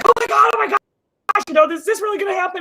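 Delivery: high, fast squeal of disbelief; excited friend. Retold or imitated. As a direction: gush like a thrilled friend who can't believe it. Friend Sarah reacting excitedly.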